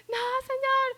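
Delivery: high wailing cry of no; crying plea. Retold or imitated. Begging God not to take her friend, crying high. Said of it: "Y lloraba".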